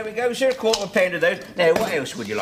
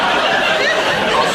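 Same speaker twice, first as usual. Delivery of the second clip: laughing; 6 dB louder than usual. Real, not acted. Laughing after fluffed line.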